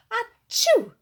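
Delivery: loud high achoo; sneeze. A narrator's reading. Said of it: "wheezed the king".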